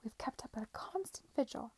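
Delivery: high, soft show of devotion; not to be outdone. A narrator's reading. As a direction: outdo him in pious concern, softly. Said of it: "not to be outdone".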